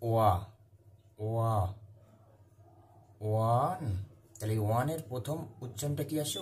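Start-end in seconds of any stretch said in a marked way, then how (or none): 0.1-3.8 ওয়া isolated as a "wa" sound, then ওয়ান; pronunciation demo. Shown as a demonstration. Teacher showing how ওয়ান begins, ওয়া sounded out separately. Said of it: "এই ওয়ানের উচ্চারণ কি আছে?".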